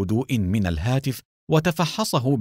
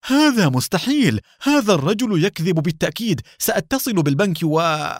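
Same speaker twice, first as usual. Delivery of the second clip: high, agitated, furious disbelief; angry raving; 8 semitones higher, 4 dB louder than usual. A narrator's reading. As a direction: rage to yourself until you stop short. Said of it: "جن جنون حسين وأخذ يتمتم بغضب". (بغضب جنوني)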